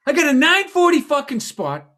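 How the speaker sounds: high, loud imitated rant; hammed-up exasperated griping about a bad night; mock venting. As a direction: vent like an aggrieved friend, pitched high. Imitating friend's voicemail rant.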